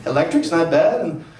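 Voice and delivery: mock German accent climbing to a high strained outburst; German accent, mild then drawn-out high panic; bad German accent. German man paying his utilities, accent rising into high outrage. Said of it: "I don't do a great German accent".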